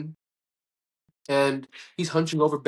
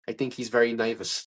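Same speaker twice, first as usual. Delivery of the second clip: low, lightly voiced confidential aside; Richard Kind; 4 semitones lower than usual. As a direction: lean in with a low, mild impression. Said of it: "Richard Kind leans into me, and he goes".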